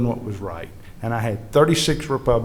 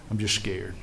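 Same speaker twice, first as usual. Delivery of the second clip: low, soft retold confession of fear; subdued retold fear; 4 semitones lower, 8 dB quieter than usual. Veteran admits he's scared before the vote, subdued. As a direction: quietly quote a frightened man's admission.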